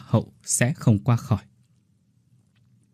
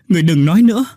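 even higher, full-force scream; louder desperate shout; 7 semitones higher, 7 dB louder, much faster than usual. A narrator's reading. Oanh screaming the plea again, louder. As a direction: cry it out at full strength, breaking. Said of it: "Oanh hét lớn".

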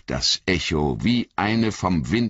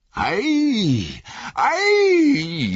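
far higher, louder anguished wail on "Ei, ei"; Legolas wailing; 4 dB louder than usual. Acted. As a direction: cry out in sheer dread. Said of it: "jammerte Legolas".

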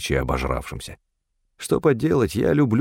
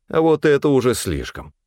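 mildly reproachful, pitched higher; gentle rebuke in a raised pitch; 6 semitones higher than usual. A narrator's reading. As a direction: chide her for going too far. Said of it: "укорил я её".

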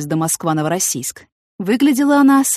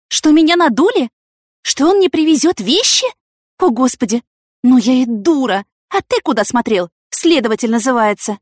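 high, loud alarm turning to reproach; dismayed heroine; 6 semitones higher, 6 dB louder than usual. A narrator's reading. Yana realising she was cheated, dismayed and accusing. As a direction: start shrieky with shock, then ease into blame.

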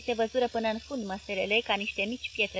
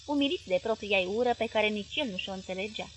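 usual speaking voice

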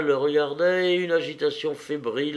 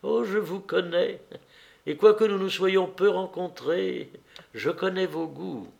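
higher voice, lightly coaxing the guest; sociable hostess, caressing; 6 semitones higher than usual. A narrator's reading. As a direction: play the hostess flattering a new acquaintance. Narrator voices Anna chatting. (hôtesse caressante, voix plus aiguë)

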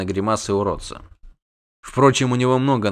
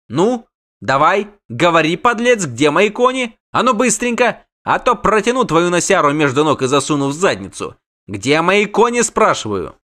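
high, pushy threats; angry interrogation; 7 semitones higher, 4 dB louder than usual. A narrator's reading. Halfling threatens doppler over stolen horses, angrily. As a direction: badger the thief, high and heated.

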